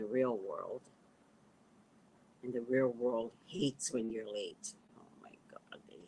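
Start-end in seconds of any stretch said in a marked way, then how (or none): 5.0-6.0 breathy whispered oh my God; whispered aside. Real, not acted. Telling viewers the world hates lateness, whispered. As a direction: drop to a whisper for emphasis.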